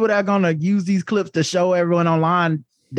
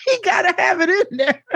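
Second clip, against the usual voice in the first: high, breaking into laughter; laughing disbelief; 9 semitones higher than usual. Real, not acted.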